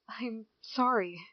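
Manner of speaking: normal talking voice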